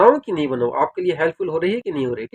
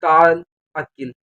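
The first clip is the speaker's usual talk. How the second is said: ताल Akil said slowly and clearly; teaching how to invite someone to eat, slowly; slow phrase demo; 2 semitones lower, 4 dB louder, slower than usual. Shown as a demonstration. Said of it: "उसको बोलेंगे आ जाओ खाना खा लो".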